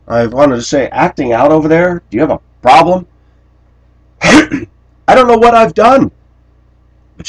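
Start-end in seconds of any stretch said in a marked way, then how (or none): 0.9-3.1 higher pretend confrontation; mock challenge. Imagined challenge to coworker. 4.2-6.1 much higher, louder feigned innocence; mock innocence. Wry aside.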